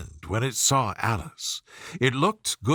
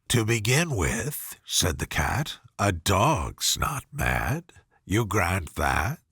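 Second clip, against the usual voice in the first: low slow Cheshire Cat; 7 semitones lower, slower than usual. A narrator's reading. The cat explaining madness to Alice, low and slow. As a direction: lower and slow, ending nearly whispered.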